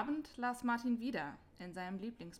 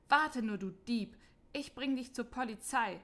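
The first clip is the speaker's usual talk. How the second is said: louder, higher furious scolding; angry shout; 3 semitones higher, 6 dB louder than usual. Acted. Market woman yells at the apple thief, angry shout. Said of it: "schrie sie wütend".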